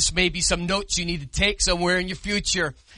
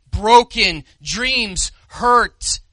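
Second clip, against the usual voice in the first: "Broken dreams hurt" drawn out very slowly, each word weighted; solemn, deliberate pulpit emphasis; 3 semitones higher, 5 dB louder, much slower than usual. Acted. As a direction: lean on every word. Preacher drives home his point.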